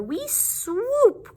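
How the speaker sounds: high, swooping squeak; bat mother. Acted. Bat mother calling her batties, high and swooping. Little bats voiced.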